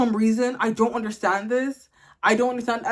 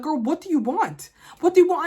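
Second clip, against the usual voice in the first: exasperated at dog; 6 semitones higher, 3 dB louder than usual. Retold or imitated. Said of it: "I'm like".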